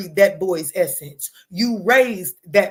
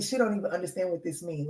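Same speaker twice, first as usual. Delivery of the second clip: ordinary talk, nothing marked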